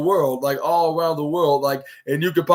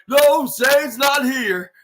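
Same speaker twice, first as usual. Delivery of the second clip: mock Vince; 9 semitones higher, much slower than usual. Mocking Vince quote.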